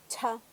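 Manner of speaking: cha with a strong puff of air after the ch; aspirated ch. Shown as a demonstration. Teacher demonstrating an aspirated cha. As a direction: release cha with a burst of breath. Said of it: "la cha aspirada, entonces va a sonar".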